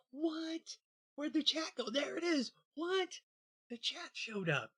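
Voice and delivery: high-pitched mock astonishment; mock surprise. Host acts out finding the vanished chat, high mock surprise. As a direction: squeal it in exaggerated disbelief. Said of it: "like this and you go".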